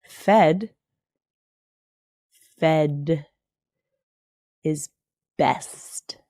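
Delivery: fed, fed is best with long pauses between each word; slow emphatic repeat. Shown as a demonstration. Repeating her motto to viewers, slow and emphatic. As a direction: spell out fed is best word by word, dropping low on best. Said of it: "Let me say it one more time for emphasis".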